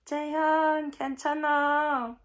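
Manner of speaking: loud high shout of comfort; Korean: 큰 소리로 외치는 위로; screaming reassurance. Retold or imitated. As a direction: scream it across the arena. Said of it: "screamed at the top of my lungs".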